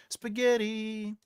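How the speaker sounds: high, drawn-out word; playful echo. Pretend. Streamer echoing a viewer's silly comment, high and drawn out. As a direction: stretch it out in a high lilt.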